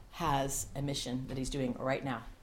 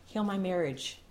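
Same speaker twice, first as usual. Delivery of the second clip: spoken prayer; 5 dB quieter than usual. Acted. Pastor praying aloud before the church, hushed and earnest. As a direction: pray it quietly.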